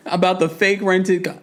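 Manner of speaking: usual speaking voice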